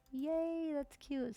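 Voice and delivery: high, bright yay; thrilled at how the bar looks; delighted squeal. Real, not acted. Pleased with placement.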